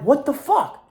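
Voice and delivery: high, shocked disbelief; stunned what the fuck. Retold or imitated. Retelling his shock at insecure friends, high disbelief. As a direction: blurt it out in sudden disbelief.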